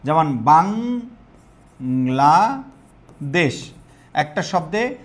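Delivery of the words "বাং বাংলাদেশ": Bangladesh drawn out very slowly in separate syllables. Shown as a demonstration. Teacher showing the class how to count syllables, Bangladesh very slowly split up. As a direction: stretch Bangladesh into বাং, লা, দেশ with long gaps. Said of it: "যতটি স্বরবর্ণ উচ্চারিত হয় ততটি অক্ষর হবে".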